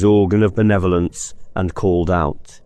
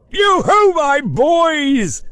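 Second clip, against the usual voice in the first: jovial Fezziwig; 3 dB louder, much slower than usual. Acted. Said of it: "said Fezziwig".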